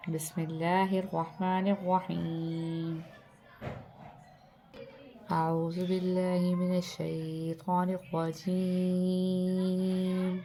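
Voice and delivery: slow, drawn-out tajweed chant; Quran recitation. Acted. Teacher reciting Surah Al-Fajr for students. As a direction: intone each verse with long held vowels.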